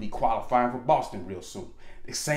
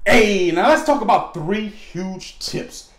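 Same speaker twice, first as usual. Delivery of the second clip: slow, loud, higher hype; excited intro; 8 semitones higher, 9 dB louder, slower than usual. Real, not acted. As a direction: announce it big. Energetic segment intro.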